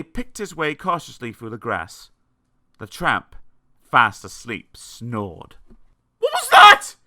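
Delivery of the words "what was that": panicked high-pitched shriek. A narrator's reading. Mister Jelly startled by a snore, shrieking. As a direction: shriek it out in sudden terror. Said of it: "shrieked Mister Jelly".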